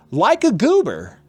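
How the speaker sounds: high, stretched-out goober voice; goofy self-mockery. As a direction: draw it out high and slow, mocking yourself. Mocks himself as goober.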